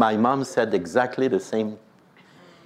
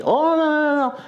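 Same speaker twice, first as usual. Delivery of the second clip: mock protest. Imitating an eager denial.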